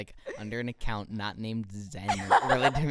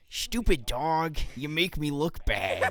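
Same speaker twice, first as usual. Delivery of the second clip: put-on slow, higher grumpy old farmer voice; Eustace Baggs; 5 semitones higher, 4 dB louder, much slower than usual. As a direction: drag out a cranky old man's complaint at his dog. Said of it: "I can do Eustace Baggs".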